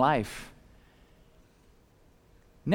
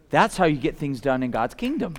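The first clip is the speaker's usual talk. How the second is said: usual speaking voice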